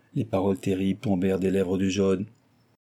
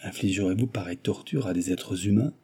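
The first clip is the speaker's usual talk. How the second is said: usual speaking voice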